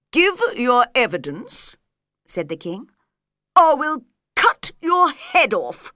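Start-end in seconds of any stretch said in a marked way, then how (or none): 0.1-1.8 a louder, commanding king; stern king. Acted. King to the Hatter, louder and commanding. 3.6-5.9 higher, louder royal threat; threatening king. Acted. King threatening the Hatter, higher and loud.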